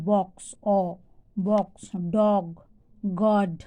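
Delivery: বক্স, ডগ, গড with an open "aw". Shown as a demonstration. Teacher giving examples of O, open "aw" in বক্স, ডগ, গড. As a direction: say বক্স, ডগ, গড apart with "aw". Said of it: "যখন অ হয়".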